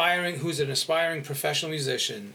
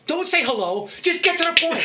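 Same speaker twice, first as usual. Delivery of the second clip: impatient; 11 semitones higher, 5 dB louder than usual. Retold or imitated. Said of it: "I'm like".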